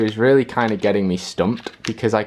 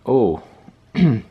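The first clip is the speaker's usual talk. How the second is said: voice crack. Real, not acted. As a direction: voice cracking mid-word, then throat clear. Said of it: "was a voice crack".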